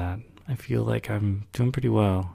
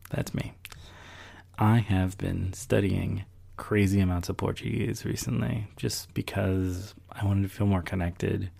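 normal talking voice

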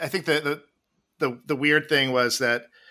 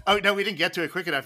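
normal talking voice

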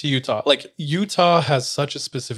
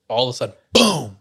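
ordinary delivery

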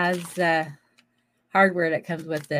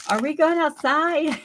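high, eager pet voice; dog voice; 9 semitones higher than usual. Pretend. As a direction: voice the excited dog. Voicing her dog.

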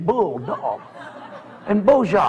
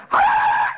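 high dog yelp; 3 dB louder than usual. Retold or imitated. Dog's yelp.

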